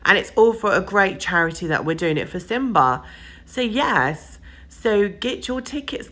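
ordinary talk

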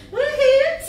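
high, loud whoop of joy; cheering woohoo. Real, not acted. Instructor cheering the class through jumping jacks, loud excited whoop. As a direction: cheer the class on at the top of your voice.

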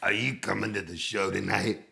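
lightly voiced singer's question. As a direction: ask it in a slightly put-on man's voice. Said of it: "And then he goes".

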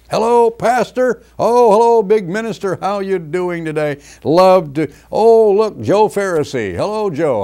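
high, sugary, over-eager hello; a syrupy mock welcome at the church door; fawning mock greeting. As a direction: gush at the big minister like a flatterer. Mocking people fawning over ministers.

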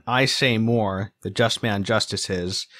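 slow, I and just leaning on the stress marks; stress shown by accents. Shown as a demonstration. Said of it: "some of the accents in here".

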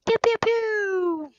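slow, high drawn-out pew noises; mouth gun sound. Pretend. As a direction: play gunshots with your mouth. Imitating gunfire sounds.

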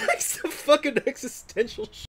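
laughing. Real, not acted. Cracking up mid-sentence.

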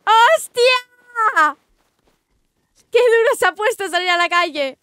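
loud, high outcry of shock; startled shout. Real, not acted. Player attacked in the street, startled shouting. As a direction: shout in real alarm, voice jumping up.

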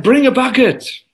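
high-pitched, urgent storyteller's command; urgent order. Acted. Teacher calls for a bucket as yogurt overflows, urgent. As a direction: call out the order high and quick.

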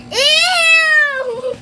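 a loud put-on squeal of disgust; fake disgusted ew. Kid fakes disgust when the toy farts, hammed-up. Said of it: "Okay, that was fake".

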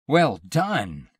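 loud, high cheer; delighted cry. A narrator's reading. Husband cheering his wife's vanishing act with a loud cry. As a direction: call out your applause. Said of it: "he cried".

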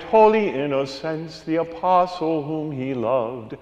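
usual speaking voice

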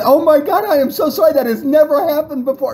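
high-pitched imitation of a flustered woman; hammed-up embarrassed dancer, voice way up; mock mortified apology. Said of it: "She is beet red".

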